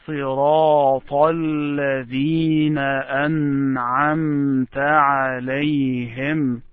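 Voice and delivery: صراط الذين joined, slow and sustained; connected recitation. Shown as a demonstration. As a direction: recite in one unbroken line with long vowels. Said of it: "make sure that you connect".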